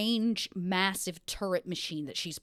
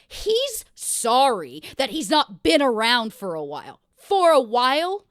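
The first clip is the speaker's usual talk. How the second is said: slow, loud, incredulous sarcasm; indignant; 3 semitones higher, 9 dB louder, slower than usual. Acted. Woman reading his apology in the letter, indignant. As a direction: build to a high, loud, offended question.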